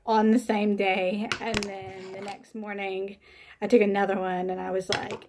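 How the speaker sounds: ordinary talk, nothing marked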